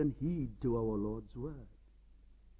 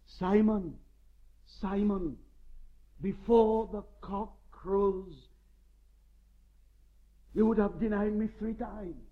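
the Lord warning; 4 semitones higher, 7 dB louder than usual. Retold or imitated. Voicing the Lord warning Peter.

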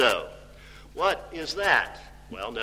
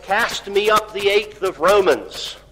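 raised, louder quoted command; dying man's urgent request; 5 semitones higher, 5 dB louder than usual. Retold or imitated. Preacher voicing Robert Bruce asking for the Bible, raised and urgent. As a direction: call it out, pitched up. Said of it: "and so he said".

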